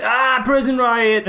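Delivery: high, slow hammy cry of alarm; mock riot call. Retelling a silly film bit, hammy high cry. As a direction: ham up a fake riot shout. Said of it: "that really hilarious bit where it's like".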